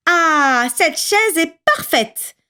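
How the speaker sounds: put-on child delighted by the little chair, slow and high. A narrator's reading. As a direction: savour finding the right chair. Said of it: "se réjouit Boucle d'Or".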